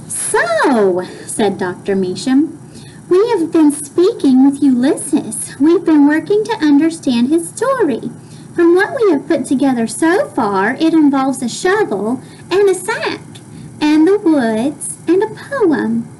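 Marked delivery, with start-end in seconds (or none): 0.1-1.0 light raised doctor voice; lightly voiced calm doctor. Doctor's voice in read-aloud. 3.1-16.1 raised, measured doctor voice; a doctor explaining gently, higher pitch. Acted. Doctor's voice in read-aloud.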